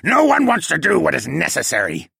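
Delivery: high, loud penguin villain; struggling yell. A narrator's reading. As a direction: cry out defiantly from under someone's grip. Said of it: "he yelled as he struggled".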